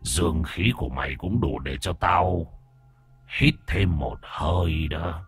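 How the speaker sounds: low, soft, slow demon drawl; gloating demon. Acted. Demon decides to toy with the boy, gloating slow menace. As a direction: savour the threat slowly, deep and quiet.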